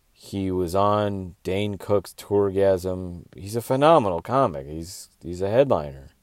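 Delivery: normal talking voice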